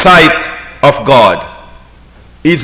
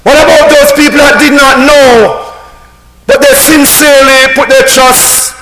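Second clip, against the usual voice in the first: preaching shout; 6 semitones higher than usual. Real, not acted. Preacher raises voice.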